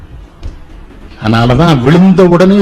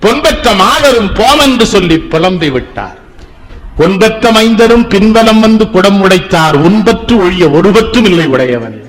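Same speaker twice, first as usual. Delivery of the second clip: put-on declaimed old verse, phrase by phrase; verse recitation; 5 semitones higher than usual. Speaker quotes a poem on kin deserting the dead, cadenced recitation. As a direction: recite it with raised, falling cadences.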